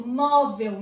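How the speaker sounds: "móvel" with a loud MÓ; stress on first syllable. Shown as a demonstration. Teacher repeating where the accent is, "móvel" stressed on MÓ. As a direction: hit the first syllable of "móvel", let the last drop. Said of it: "olha onde tá o acento".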